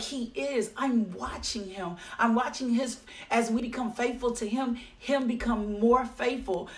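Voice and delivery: ordinary delivery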